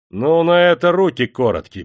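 high, loud cheeky jeer; defiant retort. A narrator's reading. A bold voice interrupts Yulka, defiant. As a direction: cut her off boldly. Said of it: "перебил её дерзкий голос".

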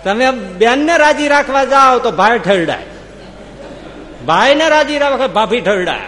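loud, high full-force sermon; preaching. Acted. Preacher telling the crowd the world never fits together, loud preaching. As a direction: drive the moral home to the crowd.

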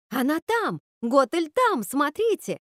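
high, urgent cry of discovery; excited shout. A narrator's reading. Ruby points the witch out in the mirror, excited shout. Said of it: "закричала Руби".

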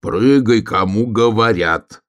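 loud, drawn-out command; high, forceful shout with stretched words; bear cub shouting. A narrator's reading. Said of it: "ещё громче крикнул медвежонок".

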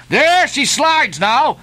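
very high, jubilant hurrah; exhilarating cry. A narrator's reading. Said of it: "with an exhilarating cry of".

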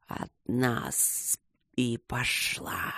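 low, soft, slow serpent aside; sniggering spirit. A narrator's reading. Spirit answers Luke about the tale, sly slow hiss. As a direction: drawl it softly with sly amusement. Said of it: "раздался смешок со стороны духа".

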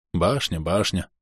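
softly tired, mildly grumbling; weary grumble. A narrator's reading. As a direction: grumble wearily with a tired smile. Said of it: "проворчал я, устало улыбаясь дочери".

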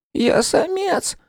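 very high, slow squeak; offended little rodent, stretched out in a tiny high voice; thin rat voice. A narrator's reading. Rat correcting the man who took him for female, thin offended squeak. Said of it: "тоненьким голосом сообщила".

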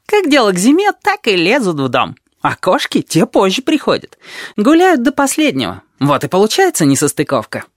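very high birdlike chatter; chirping. A narrator's reading. Tabaki explains rats and cats, high chirping. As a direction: chirp along in a high put-on voice, easing at the end. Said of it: "чирикал Табаки".

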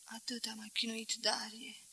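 softly whispered confession. A narrator's reading. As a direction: whisper it as if worn out. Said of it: "Șopti".

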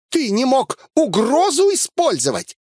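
high, indignant scolding; furious reproach. A narrator's reading. Elsa scolding the narrator, furious. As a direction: berate in a high, angry woman's voice. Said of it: "насладиться её прекрасной злостью".

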